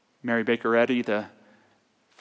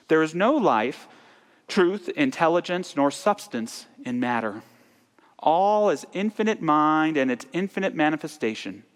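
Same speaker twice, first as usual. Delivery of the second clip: higher, declamatory creed recital; emphatic recitation; 4 semitones higher, 3 dB louder than usual. Acted. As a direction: proclaim each statement with ringing emphasis. Recites Statement of Being.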